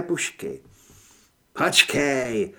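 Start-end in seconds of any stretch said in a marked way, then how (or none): 1.5-2.5 understated cry to stop someone; mild shout. A narrator's reading. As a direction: call out with a touch of urgency. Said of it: "zakřičel Earl". (mírný výkřik)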